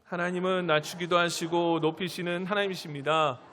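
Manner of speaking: loud, measured recitation; congregational reading. Real, not acted. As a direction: read it out firmly for everyone to follow. Leads congregation reading aloud. (또박또박 힘 있게 낭독)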